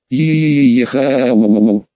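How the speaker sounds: grave, drawn-out syllables; slow motion. A narrator's reading. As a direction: stretch each syllable out gloomily. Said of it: "ещё более могильным голосом, медленно растягивая слоги".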